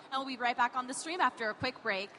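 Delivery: ordinary delivery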